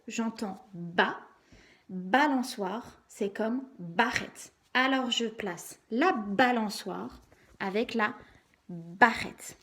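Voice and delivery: slow, with BA punched in "balançoire" and "barrette"; stressed BA. Shown as a demonstration. Teacher helping a child who can't hear it, BA stressed in "balançoire" and "barrette". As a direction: draw out and accent every "ba", in "balançoire" and "barrette". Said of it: "Vous accentuez bien à l'oral la syllabe ba".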